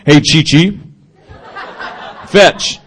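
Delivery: big-dog voice wrongly used on a little dog; big-dog talk. Shown as a demonstration. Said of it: "you can't talk like that".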